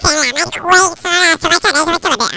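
chipmunk-high filtered voice; high-pitched voice changer. Shown as a demonstration. Showing viewers the right-hand setting, very high squeaky voice. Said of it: "ถ้าไปขวาก็จะเป็นเสียงแหลม".

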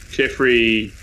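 ordinary delivery

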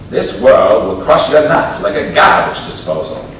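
put-on grandfather's gruff Boston accent. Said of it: "In his Boston accent".

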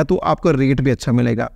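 ordinary talk, nothing marked

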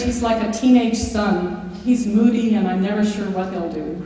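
normal talking voice

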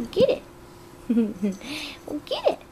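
high squealed cheer; excited squeal. Real, not acted.

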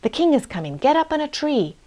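put-on mildly alarmed call to the children; crying out. A narrator's reading. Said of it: "and cried out".

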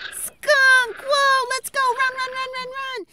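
high, loud panicked shriek; hammed-up alarm; mock panic. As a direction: scream and flee the skunk. Panic fleeing skunk.

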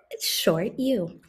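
unvoiced whisper; whispered reveal. Teacher to kids, whispering the answer. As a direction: share it like a secret.

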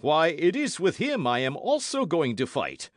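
high, loud, blustering exclamation; loud surprise. Acted. Porthos finds he shares Athos's opponent, loudly surprised.

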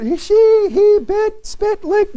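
high, loud imitation of squabbling kids; a father imitating his children tattling, high and whiny. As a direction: mimic the kids calling for mom and dad, high and loud. Kids squabbling voiced by parent.

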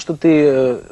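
ordinary delivery

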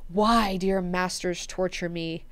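ordinary delivery

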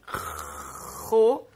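"Ro" with a long rasped R before the vowel; R sound demonstrated. Shown as a demonstration. Teacher isolates the R sound for pupils, demonstrated. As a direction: hold the throaty R, then land on O in "Ro". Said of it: "o som do R".

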